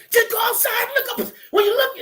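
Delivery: very high-pitched screamed directions; yelling and screaming. Shown as a demonstration. Instructor screaming directions at a class as an exercise, yelling high. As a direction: scream out the instructions at the top of your range. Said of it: "I just start yelling and screaming".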